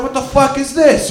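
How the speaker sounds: high, loud shocked outburst. Retold or imitated. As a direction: blurt it out in disbelief. Shocked at melted pot.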